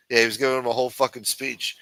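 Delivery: ordinary talk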